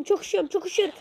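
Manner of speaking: lightly played cold shivering; mock freezing. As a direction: whine that you're freezing. Character frozen in game.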